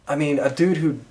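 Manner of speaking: ordinary delivery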